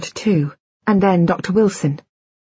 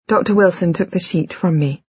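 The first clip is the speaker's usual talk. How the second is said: ordinary delivery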